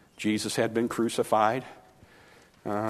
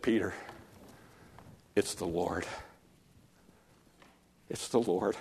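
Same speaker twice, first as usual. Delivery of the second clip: hushed, softer awed words; whispering; 4 semitones lower, 8 dB quieter than usual. Acted. John telling Peter in the boat, hushed and awed. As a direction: breathe it out in wonder. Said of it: "whispers to Peter".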